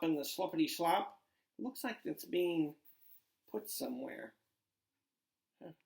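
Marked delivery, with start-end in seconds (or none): none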